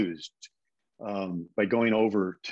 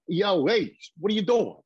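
mock New York Cockney, pitched up; bad New York accent; 8 semitones higher, 4 dB louder than usual. British actor attempting an American tough, mock New York accent. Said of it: "like a New York, a New York Cockney".

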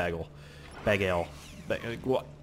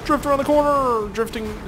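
excited shout; 12 semitones higher, 5 dB louder than usual. Real, not acted. Hyped drifting.

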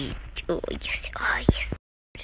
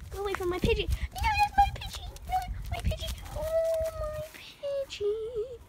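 much higher, possessive squealing; playful squeal; 3 dB louder than usual. Acted. Girl squeals possessively over her pigeon in a higher voice. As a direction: squeal it up high like a toddler claiming a toy.

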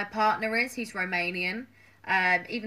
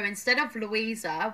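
normal talking voice

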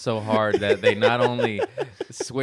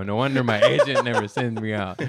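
usual speaking voice

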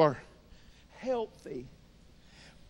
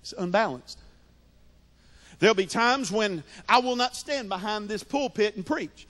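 normal talking voice

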